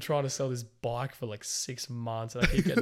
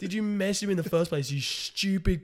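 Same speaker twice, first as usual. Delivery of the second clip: high, loud, slowed mock rage; hammed-up fury at a time-waster; angry rant; 9 semitones higher, 8 dB louder, slower than usual. Seller retelling blowing up at a lowballer, mock furious. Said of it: "I just lost it".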